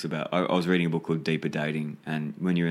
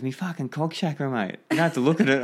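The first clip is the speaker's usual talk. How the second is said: imitating an exasperated bloke, high and raised; higher, louder mock protest at the end; insistent retort; 9 semitones higher, 4 dB louder than usual. Client answering "look at what?", insistent and high-pitched.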